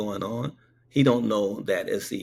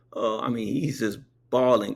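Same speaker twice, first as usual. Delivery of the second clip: usual speaking voice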